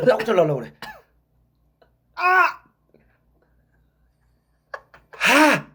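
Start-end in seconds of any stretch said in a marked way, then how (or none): 2.1-5.7 loud high yell of burning pain; pained scream. Real, not acted. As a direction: cry out, mouth on fire.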